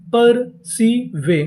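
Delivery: पर सी वे spaced slowly, syllable by syllable; very slow blend. Shown as a demonstration. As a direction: stretch each part for the class. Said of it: "इसे पढ़ेंगे वे".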